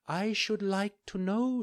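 high, gentle fairy voice; a kind fairy giving a calm order. Acted. Fairy asks doctors.

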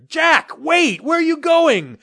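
loud high call after him. Acted. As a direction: call loudly across the distance. Father calling after running son.

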